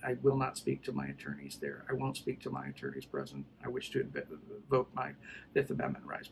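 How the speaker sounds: low, soft monotone recital; imitating a stonewalling suspect's dull refrain; repeated refusal. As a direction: recite it by rote, very low and quiet. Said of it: "I kept saying".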